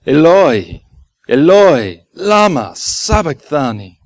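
loud, solemn cry in imitation of Jesus. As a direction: cry each word out to God, loud and spaced. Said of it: "truly were cried out in history".

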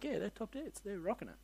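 soft, lightly acted approval; hushed admiration. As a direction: murmur it like an impressed onlooker. Acting out everyone admiring the Pharisees.

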